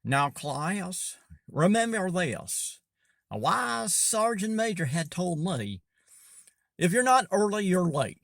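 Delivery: teasing a coworker with a fake Southern accent; mock Southern drawl. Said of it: "put on this really pseudo false Southern drawl".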